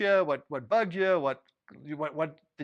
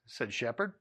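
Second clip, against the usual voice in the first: plain reading voice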